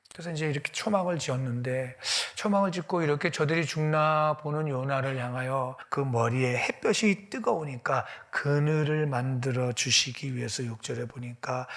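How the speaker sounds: ordinary talk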